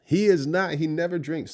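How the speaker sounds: high, loud mock objection; mimicked protesting wife. Reactor echoing wife defending her husband, loud high mimicry.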